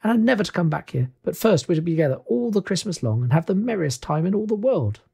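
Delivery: put-on high, excited child voice; gleeful little girl. Little sister promises a merry Christmas together, gleeful. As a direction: lilt upward with happy anticipation. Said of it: "said the child".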